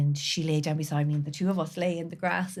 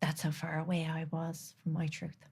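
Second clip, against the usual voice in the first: ordinary delivery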